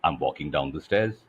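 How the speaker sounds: falling down the stairs. Shown as a demonstration. Coach showing melody, pitch dropping low. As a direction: let the voice walk downward. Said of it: "for example, I could say".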